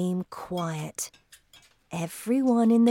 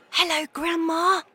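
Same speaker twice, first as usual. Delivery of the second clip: high, small mouse-boy voice, fully voiced; a high-pitched little call to Grandma, as if whispering; 10 semitones higher than usual. A narrator's reading. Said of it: "I whispered".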